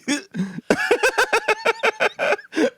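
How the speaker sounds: shrieking laugh; high burst of laughter. Real, not acted.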